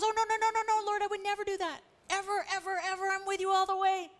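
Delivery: high, loud, fast vow of loyalty; overeager protest. Acted. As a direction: insist too eagerly, hammed-up loyalty. Peter protests to Jesus.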